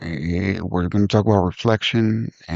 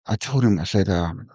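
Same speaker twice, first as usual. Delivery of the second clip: ordinary delivery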